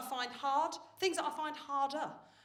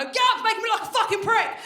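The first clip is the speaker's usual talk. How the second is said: mock furious mum; 9 semitones higher, 13 dB louder than usual. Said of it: "I wanna go".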